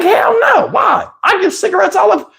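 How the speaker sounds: high, dismissive hell no; dismissive friend. Retold or imitated. Storyteller voices his hustler friend brushing off begging, high and dismissive. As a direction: brush it off as totally normal.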